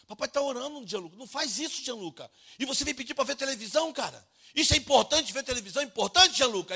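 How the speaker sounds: high, loud exasperated rant; irate, upset father. Retold or imitated. Father to son asking for TV during prayer, irate yelling. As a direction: scold the child at full indignation. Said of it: "eu tava chateado".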